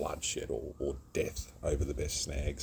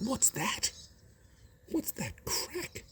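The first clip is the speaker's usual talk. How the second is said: very high, slow, whispered bird voice asking "What's that crack?"; a curious magpie whispering from a branch; magpie's whisper; slower than usual. Retold or imitated. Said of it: "even the Maggie looked. Went".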